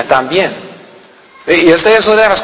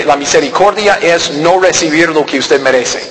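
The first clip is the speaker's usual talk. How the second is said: ordinary delivery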